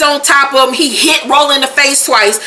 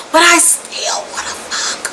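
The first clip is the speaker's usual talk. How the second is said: soft, voiceless aside; hushed confession; 5 semitones higher, 6 dB quieter than usual. Retold or imitated. Viewer admitting desire for a psycho, hushed. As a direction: drop to a near-whisper, as if admitting it.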